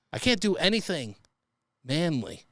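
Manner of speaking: ordinary delivery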